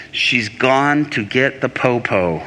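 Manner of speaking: slow, drawn-out comic realization; mock dread. Preacher retelling his fear of police, slow mock dread. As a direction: stretch it out for the laugh.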